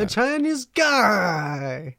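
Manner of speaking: high, slow mock Chinese accent; drawn-out caricature of a Chinese accent. Shown as a demonstration. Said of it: "how good I am at that accent".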